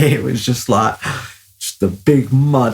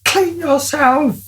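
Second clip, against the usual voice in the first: stern mum order; slower than usual. Retold or imitated. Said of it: "and she said".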